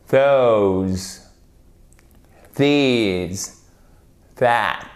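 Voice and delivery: long, throaty voiced TH opening "Those, these, that."; each of "Those, these, that." alone, from the throat; guttural TH. Shown as a demonstration. Teacher models "Those, these, that." for learners, guttural pronunciation. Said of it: "c'est très guttural".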